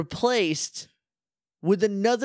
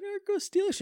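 put-on very high, soft timid girl; 4 dB quieter than usual. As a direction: squeak it out like a shy girl. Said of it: "she's like".

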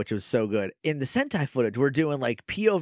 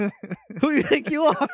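higher, laughing through the words; 13 semitones higher than usual. Real, not acted. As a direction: crack up mid-question. Laughing at a shared joke.

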